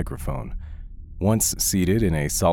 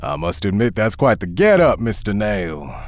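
suspicious; 7 semitones higher, 5 dB louder than usual. Acted. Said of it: "he sounded decidedly suspicious".

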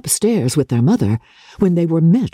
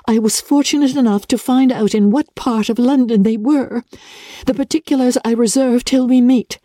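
slightly higher, measured letter reading; letter read aloud; 7 semitones higher than usual. Acted. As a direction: read the uncle's letter steadily.

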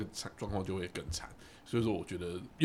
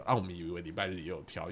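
ordinary talk, nothing marked

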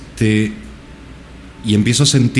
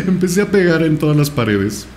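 words bubbling with real laughter; laughing while talking; 6 semitones higher than usual. Real, not acted. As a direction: laugh through the line. Laughing at his own story. (riéndose mientras habla)

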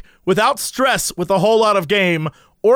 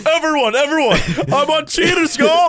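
hammed-up, higher-pitched calling out to everyone; mock excited announcement; 6 semitones higher than usual. [name] telling everyone about Cheaters, mock excited announcement. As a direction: imitate a proud show-off announcing their TV debut.

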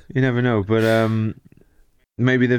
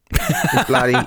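hammed-up Australian accent, pitched way up; Aussie accent; slower than usual. To Tom, showing off the terrible accents in an Australian accent. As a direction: play the broad Aussie bloke. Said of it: "My Aussie one's just nailed down".

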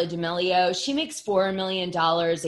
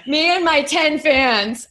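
high, slow laughing self-mockery; joking about her ten fans, laughing; 7 semitones higher, 6 dB louder, slower than usual. Real, not acted. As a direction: laugh at your own tiny fanbase. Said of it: "I'm like a nano loser".

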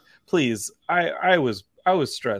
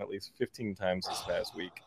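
ordinary delivery

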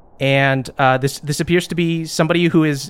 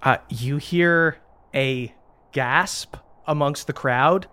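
normal talking voice

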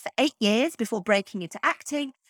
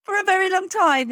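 real laugh, higher pitch; amused admission; 8 semitones higher, 3 dB louder than usual. Real, not acted. Host owning up to the same mistake, laughing. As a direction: laugh through a confession, voice lifting.

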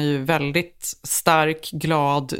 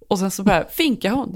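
ordinary delivery

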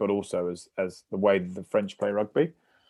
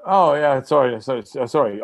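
repeated sorries tumbling out; mock-flustered, starting high and loud; retold flustered apology; 4 semitones higher, 4 dB louder than usual. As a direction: act out backtracking, embarrassed. Retold embarrassed apology to caller.

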